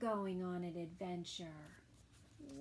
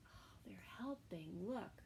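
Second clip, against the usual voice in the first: ordinary delivery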